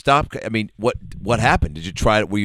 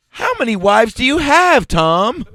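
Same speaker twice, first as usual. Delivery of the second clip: higher, louder teasing question; mock interviewer; 12 semitones higher, 5 dB louder, slower than usual. Teasing Tom Selleck, higher mock interviewer. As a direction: play a cheeky interviewer.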